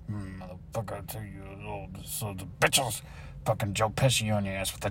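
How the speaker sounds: very low, soft cursing; quiet, bitter grumble; resentful muttering. Acted. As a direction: curse them under your breath. Cursing once boss is past.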